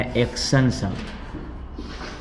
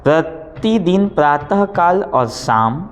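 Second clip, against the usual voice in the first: teacher dictating, raised and drawn out; slow dictation voice; 5 semitones higher, 4 dB louder than usual. Shown as a demonstration. Teacher dictating to pupils, slow and raised. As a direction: spell each word out for copying. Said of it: "आगे लिखिए".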